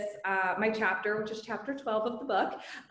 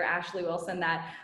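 ordinary talk

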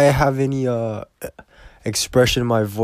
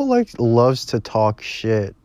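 ordinary talk

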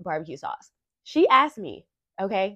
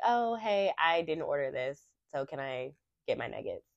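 softer, lower, easy friendly request; sweet polite ask; 5 semitones lower, 5 dB quieter than usual. Retold or imitated. To the drive-through worker, gently asking for her nuggets. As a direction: keep it light and pleasant, easy peasy. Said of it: "I just was simply like".

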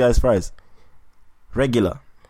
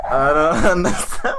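laughing; 7 semitones higher than usual. Real, not acted. Mocking friend's wing eating.

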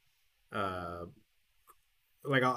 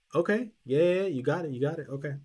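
higher, louder imitation of an eager clerk; mock over-quick reassurance, easing off at the end; eager agreement; 5 semitones higher, 4 dB louder than usual.

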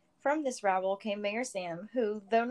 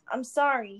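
choked, breathy apology through tears; 3 semitones higher, 6 dB louder than usual. A narrator's reading. Son apologising into father's shoulder, choked. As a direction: force the words past a tight throat. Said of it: "he choked out".

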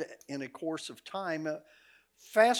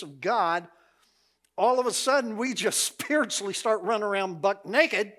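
louder, higher, laughing preacher's climax; preaching at full force; 5 semitones higher, 6 dB louder than usual. Acted. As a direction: build the sermon point with joy. Preaches the closing point.

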